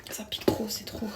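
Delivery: whispered pain from stinging eyes; whispering, eyes stinging. Real, not acted. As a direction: breathe it out, eyes burning. Ça me pique les yeux.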